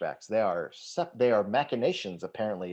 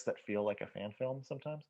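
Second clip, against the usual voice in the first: softer, confiding aside; near-whisper; 2 semitones lower, 11 dB quieter than usual. Real, not acted. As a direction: drop your voice as if admitting it quietly. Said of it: "I love that you're whispering".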